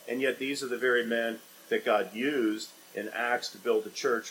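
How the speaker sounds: normal talking voice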